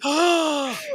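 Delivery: high, sharp intake of breath; exaggerated gasp of shock; mock shocked gasp.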